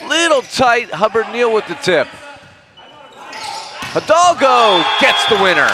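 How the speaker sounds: excited play-by-play. Real, not acted. Announcer calling the winning point to viewers, rising to a shout. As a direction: call the rally rising to a shout at the end. Live play-by-play of a long rally.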